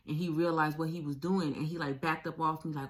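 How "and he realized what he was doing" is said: ordinary delivery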